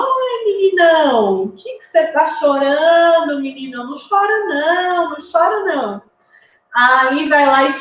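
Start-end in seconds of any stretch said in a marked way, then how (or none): 0.0-1.4 put-on high, drawn-out exclamation to a baby; talking to a baby boy. Adults consoling a crying baby in blue, high playful baby talk. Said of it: "vão lá e falam". 1.4-3.4 put-on higher, hearty baby-directed voice; talking to a baby boy. Adult to a crying baby in blue, high hearty baby talk. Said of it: "vão lá e falam". 3.4-6.0 put-on high sing-song soothing of a baby; talking to a baby boy. Adults consoling a crying baby in blue, high baby talk. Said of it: "vão lá e falam".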